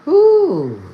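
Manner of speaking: loud, high exhale of relief; big whew. Real, not acted. Shaken speaker lets out a loud high whew. Overwhelmed after tearful story.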